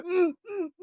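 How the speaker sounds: high strained mock grunts; straining grunt. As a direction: grunt as if throwing a punch. Friend straining while punching.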